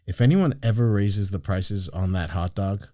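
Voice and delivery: low, quiet deadpan menace; retold boss's quiet warning; deadpan threat. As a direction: deliver the threat softly and low, lightly dramatized. Owner's deadpan threat in retold story.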